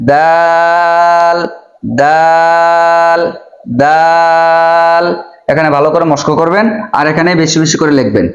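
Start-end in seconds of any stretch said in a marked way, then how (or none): 0.1-5.0 দাল with the vowel held four beats long; long drawn-out daal. Shown as a demonstration. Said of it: "দাল ভালো করে মস্ক করবেন".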